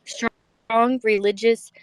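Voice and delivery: ordinary delivery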